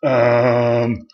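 long, drawn-out ums on one level note; stretched-out mock hesitation; imitated filler ums. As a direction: mimic your own habit of saying um. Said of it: "Stop saying, um".

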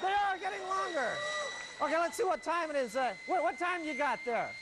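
high-pitched mock amazement at a live sight; hammed-up live-link excitement; excited calling out. Live hookup stunt, calling out excitedly.

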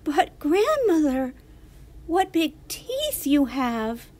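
put-on high, loud little girl, a touch afraid; slightly quivering. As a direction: let slight fear into the child's voice. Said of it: "her voice quivering slightly".

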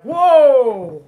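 loud, drawn-out whoa; Santa's big whoa. Acted. Santa stopping his reindeer, loud and drawn out. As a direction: halt the reindeer with a big long call.

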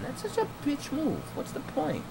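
very high, soft mocking tone; a hint of a squeaky sneer. Mock high voice.

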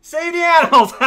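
high, loud jokey shout; mock rallying cry. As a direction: shout it like a rescue slogan, about to laugh. Shouts a game reference, then laughs.

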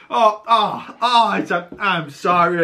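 high, flustered imitation of apologizing; flustered apology. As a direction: stammer a hasty mock apology. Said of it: "immediately just starts to friggin' apologize".

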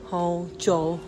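normal talking voice

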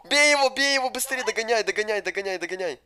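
loud, fast urging on; urgent shouting. Real, not acted. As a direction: yell at them to catch him. Urging on during a chase.

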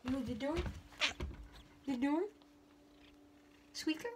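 soft coaxing. Real, not acted. Coaxing cat softly.

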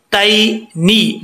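तैनी slow, with नून and ज़ेर as नी, not ने; correct reading. Shown as a demonstration. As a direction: say तैनी ending in नी. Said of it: "ने नहीं पढ़ना". (तैनी धीरे, नी, ने नहीं)

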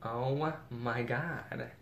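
very slow, softened amazement; drawn-out awe. Real, not acted. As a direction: stretch every word in wonder. Marvelling at the bag.

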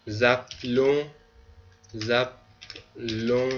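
drawn-out syllables, said slowly; slow model. Shown as a demonstration. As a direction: break the word up for learners to follow. Teacher models conjugated form slowly.

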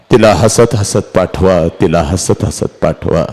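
low, sinking father's voice; heavy-hearted request; subdued plea. Acted. Father pleads, voice sinks.

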